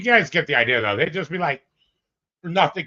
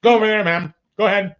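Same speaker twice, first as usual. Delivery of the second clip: loud, higher barked police commands; bossy cop orders; 9 semitones higher, 9 dB louder than usual. Retold or imitated. Host imitating the officer ordering a woman, loud and bossy. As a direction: order her around like a cop.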